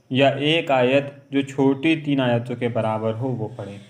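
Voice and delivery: ordinary talk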